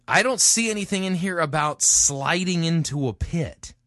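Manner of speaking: high, emphatic puzzlement; incredulous objection. Real, not acted. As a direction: object in real disbelief. Challenges the sermon's claim.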